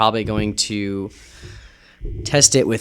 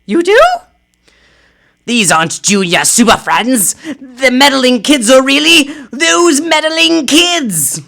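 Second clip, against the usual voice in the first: high, loud heroic Supergirl voice; a superheroine calling out in alarm; high heroine voice; 11 semitones higher, 10 dB louder than usual. Acted.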